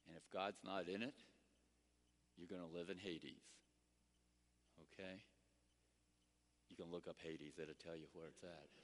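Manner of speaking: normal talking voice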